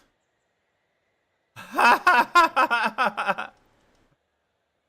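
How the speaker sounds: long falling laugh; loud laughter. Real, not acted. Laughing at the shot.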